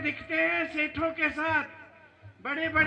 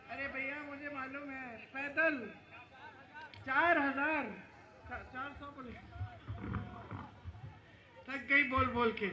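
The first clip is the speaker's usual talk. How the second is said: much softer, off-mic aside; quiet aside; 11 dB quieter than usual. Real, not acted. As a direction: drop your voice to answer someone nearby. Quiet aside after being corrected mid-speech.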